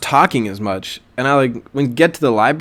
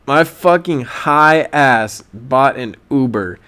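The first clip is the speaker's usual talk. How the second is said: ordinary talk, nothing marked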